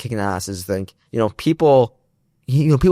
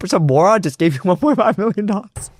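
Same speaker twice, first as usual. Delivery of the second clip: mom voice; 7 semitones higher than usual. Retold or imitated. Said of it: "My mom comes in and says".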